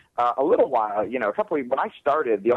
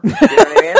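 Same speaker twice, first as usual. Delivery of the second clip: high squeaky laughing question; giggling through the question; 12 dB louder, faster than usual. Real, not acted. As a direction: laugh it out, pitched way up. Laughing at own joke.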